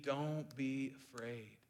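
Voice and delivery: soft, slow comforting words; tender reassurance. Acted. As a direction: say it gently as God would. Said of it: "you said to me".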